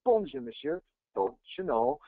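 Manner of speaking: put-on French greeting in a Minnesotan accent. Minnesota doorman greeting a hotel guest in French, mock Minnesotan accent. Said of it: "like, a Minnesotan accent".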